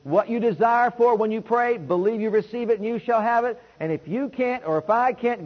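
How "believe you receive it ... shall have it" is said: normal talking voice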